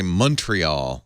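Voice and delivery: Montreal as "MUN-tree-all"; anglicized Montreal. Shown as a demonstration. Host offering another pronunciation, anglicized Montreal. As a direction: say "Montreal" English-style with a sounded t. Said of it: "as some people say".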